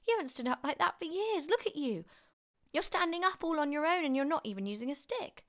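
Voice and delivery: put-on high, loud, amazed boy; excited cry. A narrator's reading. George marvels at grandma standing unaided, excited cry. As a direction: exclaim in high-pitched amazement. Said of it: "George cried".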